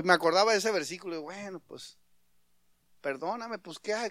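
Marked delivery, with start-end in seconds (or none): 1.2-4.1 soft, half-hearted giving in; husband meekly yielding to keep the peace; hushed, reluctant sorry; resigned apology. Retold or imitated.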